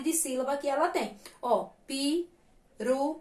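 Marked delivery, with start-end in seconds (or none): 1.9-3.1 "pirulito" very slow, pauses between all four syllables. Shown as a demonstration. Teacher helping pupils count syllables, slow and separated. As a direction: say "pirulito" slowly so each syllable can be counted. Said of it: "de uma maneira mais lenta".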